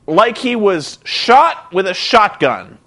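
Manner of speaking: ordinary talk, nothing marked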